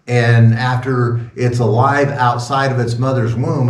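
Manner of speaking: normal talking voice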